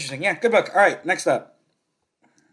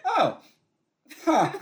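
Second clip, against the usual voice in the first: mild surprise; 12 semitones higher, 5 dB louder than usual. Retold or imitated.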